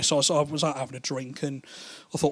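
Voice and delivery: ordinary talk, nothing marked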